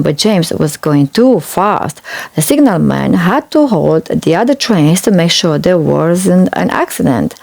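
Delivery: ordinary delivery, nothing marked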